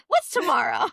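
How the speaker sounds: hunting for a better birth date, eager; eager question. Retold or imitated. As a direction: jump to the next day hopefully. Wants the next day's horoscope.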